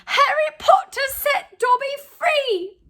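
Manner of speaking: high, loud and slow, bursting with joy; shrill house-elf. A narrator's reading. Freed house-elf gazing up at Harry, shrill delight. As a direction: squeal it out like an overjoyed elf. Dobby rejoices.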